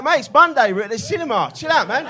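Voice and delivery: high, loud acted plea; exasperated protest. Reluctant mate answering the shot-pusher, exasperated mock protest.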